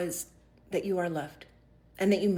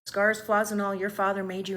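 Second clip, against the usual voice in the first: ordinary talk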